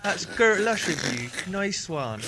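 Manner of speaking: put-on sing-song Bristol farmer accent; West Country accent. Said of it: "lilty, like, farmer vibe".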